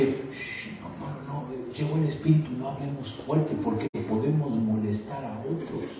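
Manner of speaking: hushed mocking whisper; hushed voice mocking quiet worshippers; mock hushing. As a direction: imitate timid shushers softly. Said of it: "mocking hushed churchgoers".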